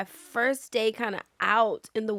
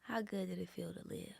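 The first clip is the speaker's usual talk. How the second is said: much softer, hushed; hushed question; 15 dB quieter than usual. Retold or imitated. Director holding actress's hands, asking softly. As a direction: ask it tenderly, almost breathing it.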